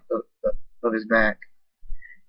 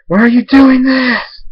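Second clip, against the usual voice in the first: high, loud, slow cry; desperate shout; 11 dB louder, slower than usual. Acted. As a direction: yell it in anguish. Hero flying at villain.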